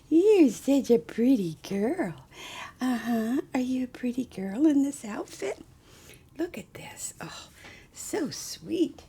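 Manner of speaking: put-on high, sing-song baby talk to a doll; cooing baby talk. Doll collector fussing over her doll in high baby talk.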